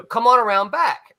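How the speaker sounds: imitated call from out of sight. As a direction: call to a visitor at the door. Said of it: "he hears a voice say".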